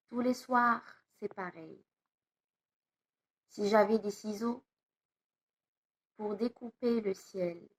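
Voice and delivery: measured poem reading with long pauses after each line; poem recitation. Acted. Teacher reads a poem aloud to pupils, measured recitation. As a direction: recite it line by line, unhurried, letting phrase endings fall.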